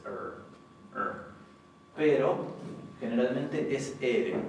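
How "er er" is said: "er" with the tongue curled back like English; English retroflex r. Shown as a demonstration. Teacher showing the possible retroflex r. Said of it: "retrofleja parecida a la de los ingleses".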